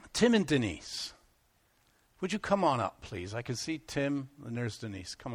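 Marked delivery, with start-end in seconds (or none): none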